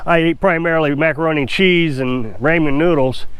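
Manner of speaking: ordinary delivery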